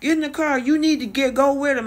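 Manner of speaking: shrill-ish pleading yell imitated; retold yelling. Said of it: "she started yelling at Ryan".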